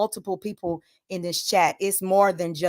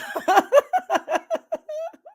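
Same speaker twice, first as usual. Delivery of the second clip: big high laugh. Real, not acted. Streamer laughing at a viewer's name, real laugh. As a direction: let out a big delighted laugh.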